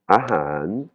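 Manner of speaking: AHAN drawn out, gliding up and down; rising-falling tone. Shown as a demonstration. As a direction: stretch "ahan" with a broken tone. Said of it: "c'est l'intonation qui monte et qui descend".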